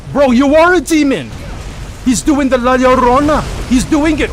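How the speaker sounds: real thrilled shout at a teammate; excited yelling. Real, not acted. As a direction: hype up a friend's big move. Teammate's demon move excites him.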